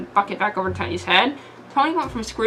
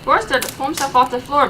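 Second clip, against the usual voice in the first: ordinary delivery, nothing marked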